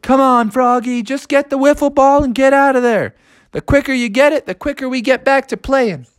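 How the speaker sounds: high-pitched urging; impatient friends calling. Acted. Friends call down to Froggy in the sewer, urging him. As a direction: egg him on from up above.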